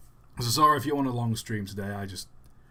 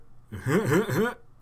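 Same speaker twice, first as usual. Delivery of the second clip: chuckling laugh in pulses, pitched up; put-on chuckle as a celebrity impression; Seth Rogen; 7 semitones higher, 4 dB louder than usual. Shown as a demonstration. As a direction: imitate a comedian's signature laugh, louder and higher. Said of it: "a Seth Rogen impression is just the laughter".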